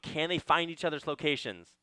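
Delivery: ordinary delivery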